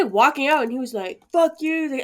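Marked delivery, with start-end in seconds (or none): 1.3-1.8 higher-pitched mock yell; retold yelling. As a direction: imitate an angry moviegoer, not at full volume. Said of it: "yelling at this other woman".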